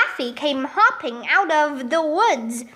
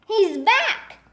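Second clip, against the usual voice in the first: mild high cheer; shouting; 6 semitones higher than usual. A narrator's reading. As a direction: call it out gladly, pitch raised. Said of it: "Everybody started shouting".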